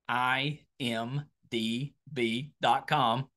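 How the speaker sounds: letter by letter, slowly, with small gaps; slow, careful spelling. Real, not acted. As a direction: take your time spelling out I M D B dot com. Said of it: "Wanna make sure to take my time with that".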